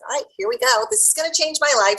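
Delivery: high, bright self pep talk; rally yourself to begin; psyched up. Retold or imitated. Psyching herself up.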